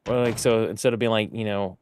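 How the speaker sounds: ordinary talk, nothing marked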